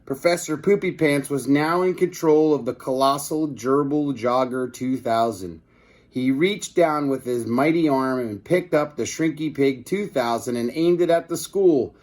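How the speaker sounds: ordinary delivery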